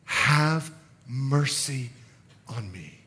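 very slow, soft, low plea; humble plea. Acted. Beggar simply asking Jesus for mercy, humble quiet plea. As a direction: plead quietly, leaving space between words. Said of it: "in his humility, he simply states".

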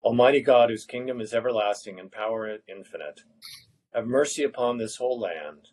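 ordinary talk

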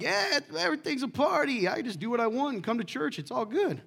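high, breezy mock party-goer voice; mock the careless believer, lightly; a high airy voice for the easygoing church crowd; carefree churchgoer. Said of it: "some of you are like".

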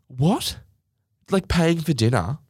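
incredulous. Real, not acted. Vlogger reacting to the husband, incredulous. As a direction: ask in disbelief. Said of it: "incredulous reaction".

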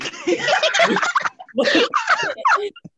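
high squealing laugh; shrieking laugh. Real, not acted. Laughing at search results.

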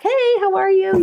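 very high, bright hello; cheery greeting. Retold or imitated. Classmates greeting each other on Zoom, high and cheery. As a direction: sing out the greeting.

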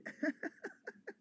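high, delighted giggle; excited giggle. Real, not acted. Preacher laughing in excitement about the seed offering, high giggle.

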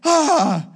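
acted yelp of shock; mock startled cry. Acts the startled disciples.

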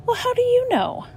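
breathless high whisper, as if afraid to be heard; frightened whisper. Acted. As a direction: whisper it high and scared, barely voiced. Frightened boy whispering to lion.

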